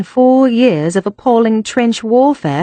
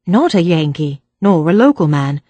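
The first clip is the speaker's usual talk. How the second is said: usual speaking voice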